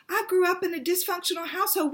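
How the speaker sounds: over the phone, bursting with joy at the discovery; excited. Retold or imitated. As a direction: rattle it off, delighted. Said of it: "I'm just so excited".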